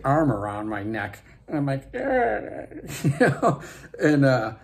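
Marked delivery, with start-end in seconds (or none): 1.9-2.6 high strangled croak; mimed choke, as if in a headlock; choking noise. Retold or imitated. Mimes being choked by a gigantic arm.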